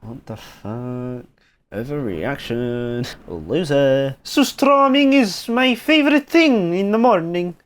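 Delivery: mock Swedish accent, drawn out; slow, hammed-up Swedish accent. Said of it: "like, Swedish people going".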